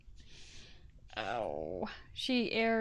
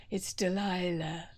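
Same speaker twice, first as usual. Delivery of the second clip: Delilah radio voice; 4 semitones lower than usual. Pretend. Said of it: "That's your D J voice".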